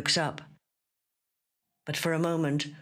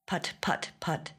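soft whispered helicopter put-put, trailing off; 3 semitones higher, 5 dB quieter than usual. Acted. Narrator recalling the far-off helicopters, whispered put-put. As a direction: breathe out a faint distant rotor sound. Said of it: "Not the put-put we have heard".

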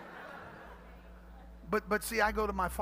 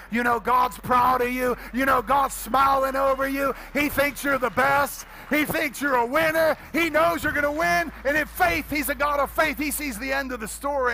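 loud, higher rousing proclamation; full-force preaching; 5 semitones higher, 7 dB louder than usual. Real, not acted. Pastor assures congregation God is proud, preaching forcefully. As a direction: build it up and drive each line home.

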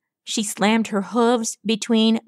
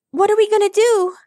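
put-on fast, high, loud child's panic; frightened squeak; 12 semitones higher, 5 dB louder, faster than usual. A narrator's reading. Scared Rockspot asking Rainbow Dash for help, panicked squeak. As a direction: squeak it out in a hurry, terrified. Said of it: "Rockspot squeaked, trembling".

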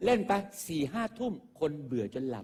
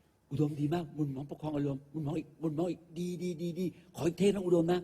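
mock film director fawning over the actor; gushing director's praise, hammed up. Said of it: "เทคแรกผมเล่นให้แม่งนะ".

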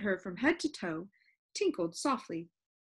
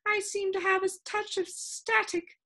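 put-on high, slow complaining old lady voice; a fussy, lilting lady with static in her; complaining sound keeper; 9 semitones higher, 4 dB louder than usual. Sound Keeper answering the visitor, high and complaining. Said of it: "she complained".

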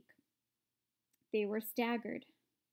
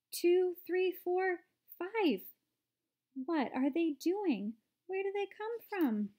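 high-pitched, amazed exclamations; excited shouting; 7 semitones higher than usual. A narrator's reading. Everybody reacts to the little men, excited shouting. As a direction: call out like an astonished crowd, one after another. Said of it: "started shouting at once".

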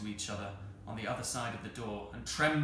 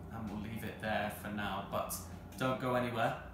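ordinary delivery

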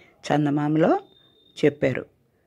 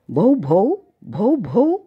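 higher, louder mock bark "bow bow"; dog barking; 7 semitones higher, 5 dB louder, much slower than usual. Grandmother as a puppy teaching the kitten, mock barking. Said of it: "ఇదిగో నా భాషలో అడుగు".

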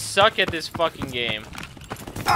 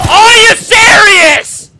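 very high, very loud drawn-out scream; outraged scream; 14 semitones higher, 12 dB louder, much slower than usual. Real, not acted. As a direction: scream in total disbelief. Cut off by being killed.

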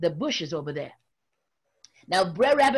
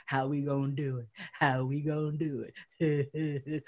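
Brer Bear scheming; 6 semitones lower, 7 dB quieter than usual. Acted. Brer Bear plotting, chuckling.

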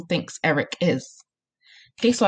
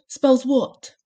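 higher squealed answer; 7 semitones higher, 3 dB louder than usual. A narrator's reading. Boy held by his ears finishing his spelling, squealing. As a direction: squeal it out under duress. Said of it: "he squealed".

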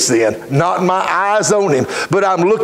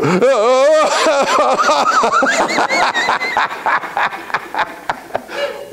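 drawn-out high cackle of a laugh; long high laugh; 12 semitones higher than usual. Retold or imitated. Drunk man handed a Bible, laughing.

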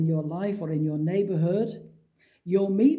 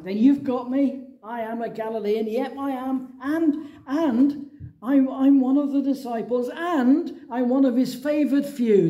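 higher, louder, emphatic imagined admission; mock bold confession; 5 semitones higher, 4 dB louder than usual. Preacher imagining Peter owning up, mock-bold and emphatic. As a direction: own up with mock pride, piling it on.

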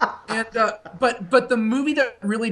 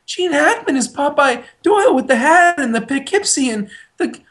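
gushing enthusiasm; 9 semitones higher than usual. Retold or imitated. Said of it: "I was like".

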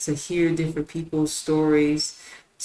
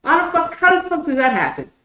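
ordinary delivery

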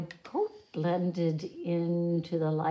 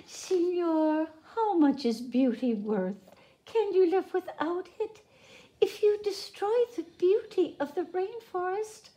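much higher, wistful creature voice; gentle sloth; 11 semitones higher than usual. A narrator's reading. Sloth asks the woodcutter, high and wistful.

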